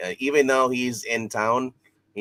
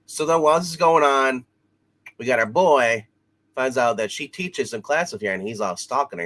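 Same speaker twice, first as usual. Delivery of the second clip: ordinary delivery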